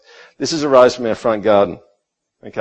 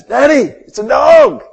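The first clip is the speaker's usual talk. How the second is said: put-on high-pitched, loud little kid squeal; excited two-year-old; 7 dB louder, slower than usual. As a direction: shriek it like an excited toddler calling Dad. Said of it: "And he goes".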